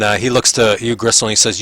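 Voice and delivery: ordinary talk, nothing marked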